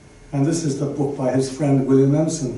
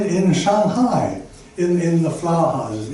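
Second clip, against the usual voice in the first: ordinary talk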